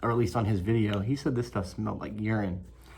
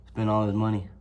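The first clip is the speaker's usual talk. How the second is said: usual speaking voice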